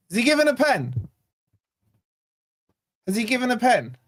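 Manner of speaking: hope starting to take over; rising excitement. Real, not acted.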